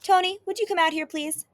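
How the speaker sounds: high, quick call to someone offstage; calling off stage. A narrator's reading. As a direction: call out a polite summons. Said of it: "I call off stage".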